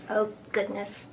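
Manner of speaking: plain reading voice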